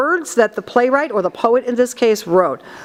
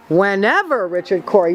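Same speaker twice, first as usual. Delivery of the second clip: "Whenever" rising to a peak on "ever", then "Richard Cory" lower; pitch up on Whenever; 4 semitones lower than usual. Retold or imitated. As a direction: imitate her lift in the middle of "Whenever". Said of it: "You heard it go up".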